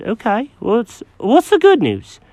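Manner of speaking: high, fussy cat voice; Jenkins the cat. Acted.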